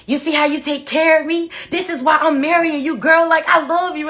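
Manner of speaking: high, loud mock gangster boyfriend; gangster fiancé. Fiancé praising his woman's care, mock gangster and loud. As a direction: hype her up like a street guy. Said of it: "he was really gangster".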